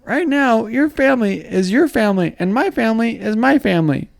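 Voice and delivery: cartoon character voice. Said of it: "like a cartoon character".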